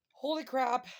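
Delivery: loud, high burst of astonishment; amazed exclamation. Real, not acted. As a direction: blurt it out loudly, truly stunned. Reader reacts to the cards.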